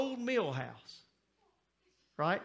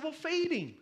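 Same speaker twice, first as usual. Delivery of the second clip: high-pitched exasperated retort; frustrated husband; 10 semitones higher than usual. Retold or imitated. Husband to wife over hungry baby, high frustrated retort. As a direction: say it high, as if it's obvious. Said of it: "And I'm frustrated".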